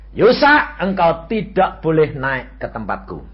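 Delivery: Jesus forbidding the dead man heaven, firm; lightly voiced Jesus. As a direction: refuse him with loud authority. Jesus refuses him.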